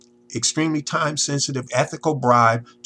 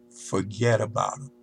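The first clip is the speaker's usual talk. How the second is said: ordinary talk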